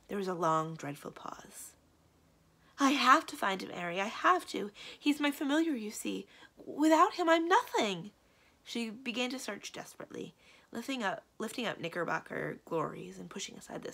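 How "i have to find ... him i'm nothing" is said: high, loud, desperate plea; anguished. Acted. Witch begging magician to help find her familiar, anguished. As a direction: plead desperately, rising to a wail. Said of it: "in a voice so full of anguish and disbelief".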